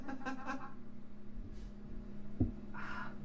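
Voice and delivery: breathless laughter; high wheezing laugh. Real, not acted. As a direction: laugh hard at the joke. Laughs at own bit.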